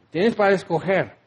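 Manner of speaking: ordinary delivery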